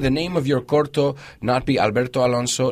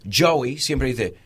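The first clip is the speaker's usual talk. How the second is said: usual speaking voice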